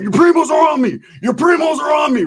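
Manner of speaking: high, hammed-up cry for help; mock panicked shout. Roach warns his friend mid-brawl, high mock yell.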